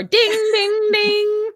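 high sing-song ding ding like a boxing bell; mock ring announcer ringing the bell; bell imitation.

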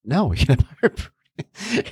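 amused mock refusal, breaking into laughter; laughing refusal. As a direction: crack up while retelling a parent's no. Laughing while retelling refusal to son.

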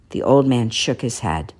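ordinary delivery

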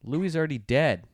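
ordinary delivery

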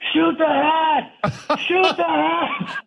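high, slow drawn-out yell; shouting. Retold or imitated. Shouting to the drone crew to film the rolling hat, a high, slow drawn-out yell. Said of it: "and I just was shouting".

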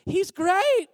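GREAT pitched high and loud; insincere praise inflection. Retold or imitated. People talking about commander Naaman, high insincere GREAT. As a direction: overdo GREAT, high and loud, with a "but" coming. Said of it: "that inflection of the voice".

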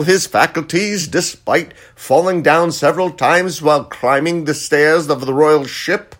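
high, loud proclaiming town crier. Acted. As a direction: boom it out like a royal herald. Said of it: "That's my town crier impression".

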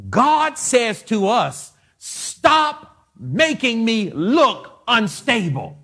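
full-force preaching. Acted. Preacher commanding his congregation to die to self, shouting. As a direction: pound every line at the church like a revival preacher.

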